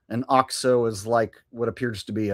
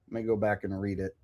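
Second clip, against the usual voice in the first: ordinary delivery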